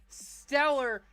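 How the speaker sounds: ordinary talk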